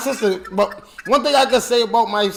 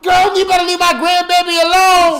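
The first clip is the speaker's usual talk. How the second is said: higher, louder scolding grandma; stern grandmother; 8 semitones higher, 5 dB louder than usual. Retold or imitated. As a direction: snap at her like a protective grandma. Grandmother voiced scolding mother.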